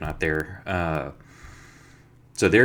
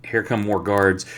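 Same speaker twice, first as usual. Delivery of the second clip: ordinary talk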